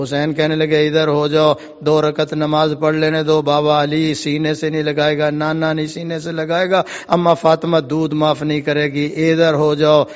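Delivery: subdued, sorrowful sermon narration; solemn narration. Acted. Hushed narration of martyrdom.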